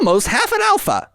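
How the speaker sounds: high-pitched celebratory announcement; triumphant. Real, not acted. Celebrating being almost half an alpha, triumphant. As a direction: crow it with soaring pitch.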